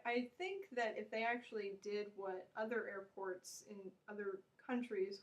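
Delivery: usual speaking voice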